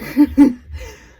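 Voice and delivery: sudden loud cackle; loud real laughter; loud burst of laughter. Real, not acted.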